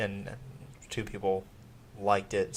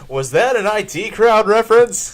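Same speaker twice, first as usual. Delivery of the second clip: excited; 10 semitones higher, 12 dB louder than usual. Retold or imitated. Excited nerdy recognition.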